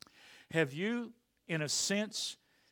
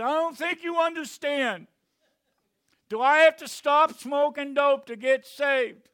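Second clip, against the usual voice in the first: high, loud yell from the crowd; stoned heckler yelling; 13 semitones higher, 14 dB louder than usual. Retold or imitated. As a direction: shout it up at the stage. Stoned heckler yells again.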